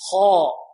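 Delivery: خاء said heavy, lips not rounded; heavy kha. Shown as a demonstration. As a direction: model خاء with full emphasis. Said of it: "خاء ننطقها بدون أن نضم الشفتين". (خاء مفخمة دون ضم الشفتين)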